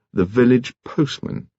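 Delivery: plain reading voice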